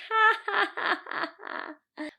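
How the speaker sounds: high mocking ha ha fading out; girl teasing with a smirk; teasing laugh. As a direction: laugh at her scornfully. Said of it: "She's like".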